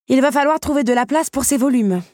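high, loud call to the whole room; announcing to all. A narrator's reading. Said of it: "annonça-t-elle à la cantonade". (annonce à la cantonade)

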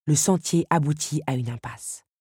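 plain reading voice

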